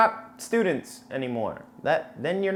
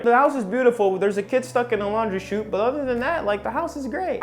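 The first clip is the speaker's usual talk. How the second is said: higher-pitched, put-on realtor patter; mock sales pitch; 8 semitones higher than usual. Seller to buyers with a kid stuck, mock upbeat. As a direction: pitch the house while glossing over a problem.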